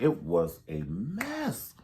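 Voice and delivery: slow, stretched-out It was a mess; a long, lingering it was a mess; drawn out. Real, not acted. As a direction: let each word sag slowly. Repeating her point, drawn out.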